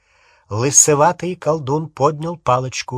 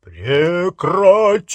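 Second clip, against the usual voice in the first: loud, drawn-out booming shout; thunderous command; 5 semitones higher, 6 dB louder, much slower than usual. A narrator's reading. Harry orders the wizards to stop, thunderous command. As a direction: fill the whole hall with it. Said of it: "громыхнул Гарри мощным".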